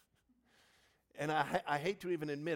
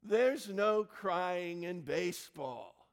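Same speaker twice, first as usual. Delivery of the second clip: slow, louder, every word laid down; famous line; 6 semitones higher, 8 dB louder, much slower than usual. Retold or imitated. Pastor quoting the manager's famous rule, slow and emphatic. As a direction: deliver the movie line slow and deliberate. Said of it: "he utters that immortal line".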